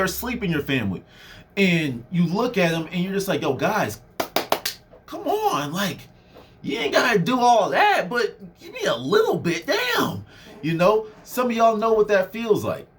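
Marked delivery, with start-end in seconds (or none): 3.4-10.2 pleading, pitched way up; exasperated plea. Retold or imitated. Speaking to sleeping family members, pleading high. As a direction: beg the family to give a little, voice cracking high. Imitating frustrated talk to family.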